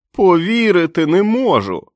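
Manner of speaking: high, loud cry of disbelief; can't believe his eyes, bursting; overjoyed. A narrator's reading. Billy runs to his returned friends, high and loud with joy.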